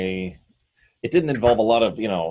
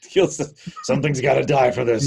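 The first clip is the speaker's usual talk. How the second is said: lightly voiced dim brute, a bit higher; echoing the brute; 5 semitones higher than usual. Player echoes the game master's dim orc, lightly voiced. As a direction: echo the orc's dumb bloodlust.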